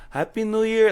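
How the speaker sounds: put-on high, bright Mexican Spanish accent. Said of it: "in a Spanish accent".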